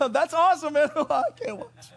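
laughing loud and high through the words. Real, not acted. Preacher laughing while retelling son's bike stunt.

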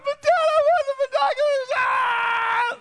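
high, loud wailing boy, hammed-up tears; mock crying child. Boy crying to his dad for the binoculars, mock wail. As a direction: wail it like a kid failing the test. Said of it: "And I was crying".

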